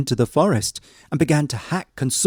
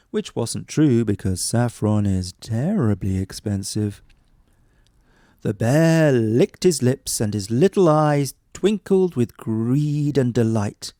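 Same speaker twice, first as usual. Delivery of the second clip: plain reading voice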